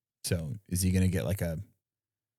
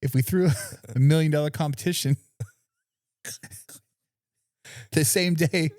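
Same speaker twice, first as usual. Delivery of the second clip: laughing through a bad, much higher Aussie voice; botched Australian accent; 9 semitones higher than usual. Host mocking Gordon Ryan in a bad Australian accent. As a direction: ham up the Aussie accent while cracking up. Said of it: "botch this fucking Australian accent".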